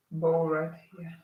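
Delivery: ordinary delivery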